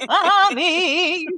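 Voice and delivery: put-on child's mommy call. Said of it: "Okay, anyway".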